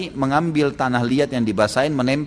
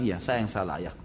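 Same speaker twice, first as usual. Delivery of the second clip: meek admission; 6 semitones lower, 10 dB quieter than usual. Acted. Son admits fault.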